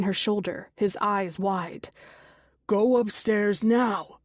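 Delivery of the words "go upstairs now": slow, louder commanding order; shouted order. Acted. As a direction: bark it out slow and firm. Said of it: "he shouts".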